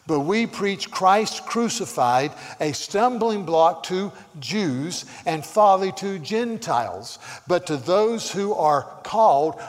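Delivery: loud, slow, emphatic preaching; weighty pulpit reading, each key word punched; forceful scripture reading. Real, not acted. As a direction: proclaim the verse to a congregation.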